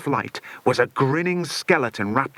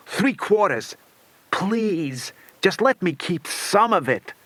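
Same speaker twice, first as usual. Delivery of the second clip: begging; 6 semitones higher, slower than usual. A narrator's reading. Villain begging Bill.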